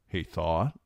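ordinary delivery

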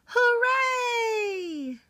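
the echo shouting back across the pond. Acted. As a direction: send the cheer back like a far echo. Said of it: "the echo shouted back".

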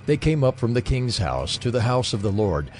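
normal talking voice

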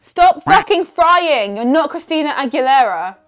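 loud, high, indignant hate-comment read; mock outraged yell. Reading a commenter's complaint about frying, mock outraged yell. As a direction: shout it like an irate commenter.

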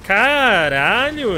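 loud, long drawn-out curse; stunned exclamation. Real, not acted. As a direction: blurt it out in shock. Reacting to a close call.

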